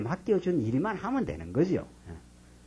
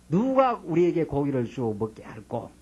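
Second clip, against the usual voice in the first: ordinary delivery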